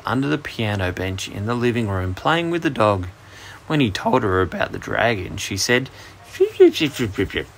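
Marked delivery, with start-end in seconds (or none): 6.3-7.5 put-on squeaky baby noises sliding down from very high; high baby-like chirping. A narrator's reading. Baby sister answers her brother's dragon news, squeaky babble.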